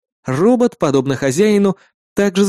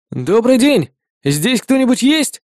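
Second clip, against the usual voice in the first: calling out; 7 semitones higher, slower than usual. Acted. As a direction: call out into the dark house. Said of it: "прокричал я".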